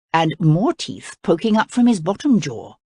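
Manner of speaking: ordinary delivery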